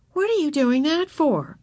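lightly voiced girl, pitched high; puzzled sister. A narrator's reading.

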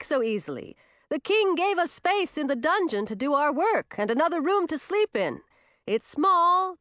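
high, hearty character voice; reined-in but still booming witch; proud, big-voiced witch. A narrator's reading. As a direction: keep it high and proud, just short of bellowing. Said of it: "quieter voice that was still louder".

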